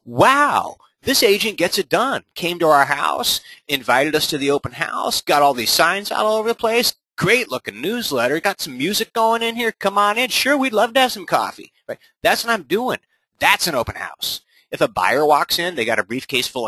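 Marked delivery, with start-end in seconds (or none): 0.0-11.7 higher, eager homeowner voice; impressed homeowner. Retold or imitated. Homeowner praising the agent at the open house, lightly gushing. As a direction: gush like a won-over neighbor. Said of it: "their experience of me is".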